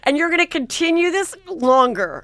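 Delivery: loud, high, laughing through the words; exasperated laugh at her husband; laughing disbelief. Retold or imitated. Wife's exasperated retelling.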